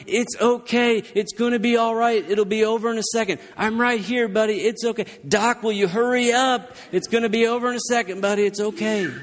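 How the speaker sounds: put-on calm the boy while near tears; teary comforting. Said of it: "I'm all teary trying to tell Emmett".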